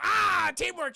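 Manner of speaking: high, loud mock cheer; retold whoop of team spirit; excited cheer. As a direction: burst out loud and high as a hyped-up buddy. Excited cheer acted in film retelling.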